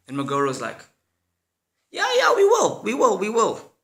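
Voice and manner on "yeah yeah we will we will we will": high put-on voice, stalling; a high, easygoing brush-off; laid-back friend Magoro.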